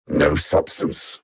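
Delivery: low, slow, voiceless dismissal; scornful aside. Acted. Alien villain dismissing Harley Quinn in a low, slow sneer. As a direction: breathe the scorn out slowly.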